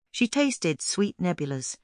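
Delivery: ordinary delivery, nothing marked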